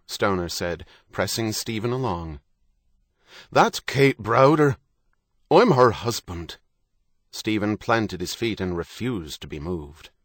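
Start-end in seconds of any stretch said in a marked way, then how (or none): 3.5-6.6 loud, high, slow-paced protest; indignant husband. A narrator's reading. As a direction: assert your claim forcefully. Husband objects to being shut out.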